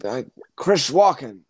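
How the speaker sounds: slow, confused Biden doing Walken. Shown as a demonstration. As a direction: Biden fumbling a Walken impression. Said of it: "Can you do Biden doing an impression of Walken".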